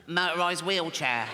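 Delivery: wheezy child impression with a bad chest; funny little boy's voice. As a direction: put on a strained little lad's voice. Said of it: "He's got a funny little voice".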